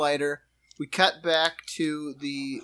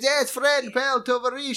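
higher-pitched mock Russian "tavarish"; light Russian accent; 7 semitones higher than usual. Retelling Yakov's reply in a light Russian accent. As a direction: echo the words with a hint of a Russian accent. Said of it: "And Yakov gets".

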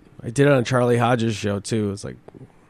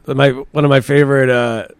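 normal talking voice

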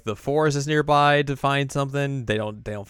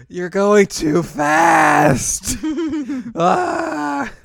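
much higher, loud, slow crying wail; whiny wail; 10 dB louder, much slower than usual. Retold or imitated. Yui falling behind while singing, whiny wail. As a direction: wail like you can't keep up. Yui whining mid-song.

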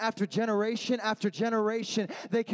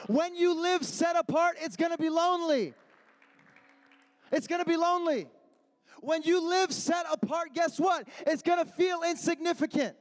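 rising, ringing sermon refrain, shouted at the peaks; fiery youth-pastor preaching; preaching at full force; 6 semitones higher than usual. Acted. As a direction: preach it like a crescendo to the crowd. Preacher building to a climax.

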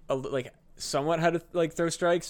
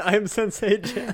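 high, drawn-out mocking echo; mock sensei; 7 semitones higher, 4 dB louder than usual. Friend teasing a would-be sensei, high mock introduction. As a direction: echo it slowly in a silly high voice.

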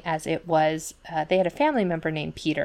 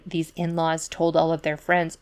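ordinary talk, nothing marked

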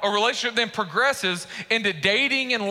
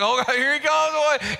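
giddy, higher-pitched mock crush reaction; giddy excitement; 3 semitones higher than usual. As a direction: act out someone giddy at seeing their crush. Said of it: "you kinda get a little giddy".

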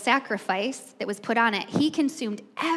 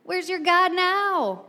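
higher, slow jeering taunt; mocking taunt; 6 semitones higher, slower than usual. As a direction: needle the prophets, higher and drawn out. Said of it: "I'm gonna start taunting you".

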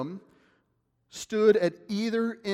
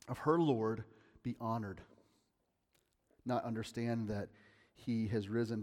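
ordinary talk, nothing marked

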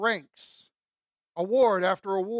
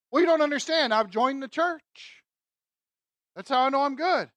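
raised, higher-pitched mock protest; defensive insistence; 4 semitones higher, 4 dB louder than usual. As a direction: plead your case as a self-assured church member. Objector insists on church membership.

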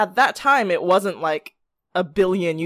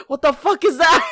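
shocked shout; 9 semitones higher, 4 dB louder than usual. Retold or imitated. Everyone reacting in shock.

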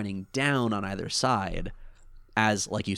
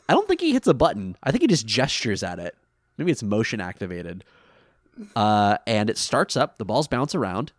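normal talking voice

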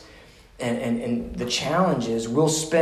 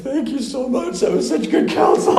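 high, squeaky sobbing thanks, hammed up; mock tearful meltdown; 12 semitones higher, 5 dB louder than usual. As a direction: imitate a client blubbering in gratitude. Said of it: "melting down, crying in a ball".

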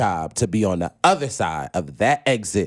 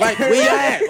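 louder, higher call across the room; 12 semitones higher, 5 dB louder than usual. Retold or imitated. Telling his friends to photograph him hugging Oprah, calling out. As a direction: yell to friends to snap the photo.

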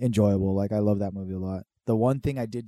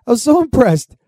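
excited; 11 semitones higher, 10 dB louder than usual. Real, not acted. Amazed retelling.

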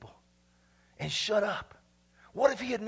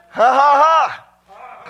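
very loud "ha, ha, ha"; booming shouted laugh; exultant laugh; 4 semitones higher, 13 dB louder, much slower than usual. Real, not acted. Preacher exulting before the congregation, loud shouted laugh. Exultant outburst after the point.

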